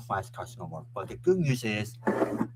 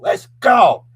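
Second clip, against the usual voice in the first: loud, high shout of team spirit; rallying cheer; 11 semitones higher, 17 dB louder than usual. Real, not acted. Capping praise of an unstoppable team, triumphant shout. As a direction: yell it like a fired-up fan.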